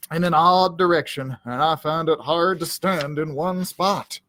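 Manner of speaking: usual speaking voice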